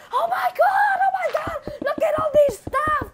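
high-pitched, breathless excitement; amazed squeal. Real, not acted. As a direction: cry out in amazement. Discovers someone's stash.

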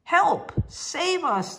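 high, loud cry for rescue; stranded pair calling up to a balloon for help; yelling for help. Acted. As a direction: shout up at the passing balloon. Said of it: "they yelled".

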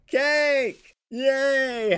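high, loud celebratory shout; excited cheer. Real, not acted. Cheering for birthday cake.

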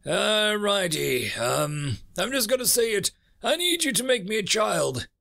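high voice, bracing to ask; awkward universe. Acted. Universe asking humans for a child, awkwardly resolved.

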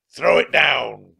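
slow, loud, high pirate bellow; drawn-out villain shout; pirate captain crying out. A narrator's reading. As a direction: bellow a greedy order. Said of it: "cried Captain Bones".

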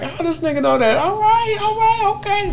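high-pitched mock disbelief; imitating surprised older musician. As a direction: jump way up high, as if amazed and conceding. Retelling the older artist's surprised reaction.

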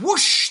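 loud, rushing "whoosh"; explosive storybook sound effect; broomstick whoosh. A narrator's reading. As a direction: send the broom off with a big whoosh. Broom takes off.

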